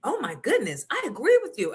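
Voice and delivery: high gasp then quick agreeing; surprised agreement. Retold or imitated. Counselor agreeing with the mom, high surprised voice. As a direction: gasp and side with her at once. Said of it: "She's like".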